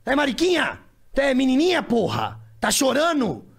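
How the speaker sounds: mock yell at a crying kid; imitating angry father. Father scolding crying son, imitated harsh yell. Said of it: "ele falava".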